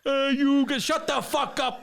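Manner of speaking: high, brash New York tough guy; Joe Pesci impression. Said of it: "Joe Pesci in The Goonies".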